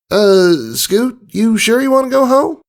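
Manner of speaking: high filly voice, pleading. A narrator's reading. Apple Bloom pleading with Scootaloo to skip going home. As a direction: beg her not to go home. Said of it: "asked in a pleading tone".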